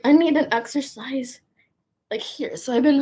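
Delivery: ordinary delivery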